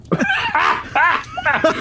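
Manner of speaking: shrill screaming laugh; high shrieking laugh. Real, not acted. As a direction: cackle up high. Burst after fan comment.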